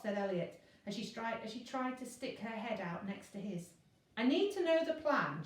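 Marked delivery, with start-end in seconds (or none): 4.2-5.4 higher, louder insistent push; insist, won't be brushed off. A narrator's reading. Virgo insisting to Elliot on knowing the plan, pushy. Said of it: "she insisted".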